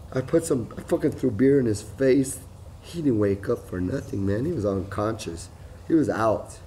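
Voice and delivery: ordinary talk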